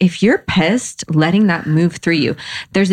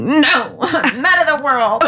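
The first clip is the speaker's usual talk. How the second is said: mock huffy reply; 8 semitones higher than usual. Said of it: "And you're like".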